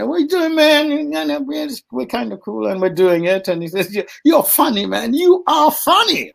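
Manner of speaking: high, laid-back imitation of Will; Will's voice. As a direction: play a breezy young star. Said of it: "And Will came and said".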